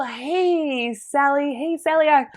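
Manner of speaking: high, loud sugary hello; fake-friendly greeting. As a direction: lay the sweetness on thick. Greets the raffle winner.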